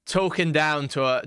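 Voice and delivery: ordinary delivery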